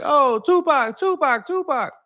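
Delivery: high-pitched mock scream, not full volume; imitated screaming. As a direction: call out like girls screaming a star's name. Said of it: "that were screaming like".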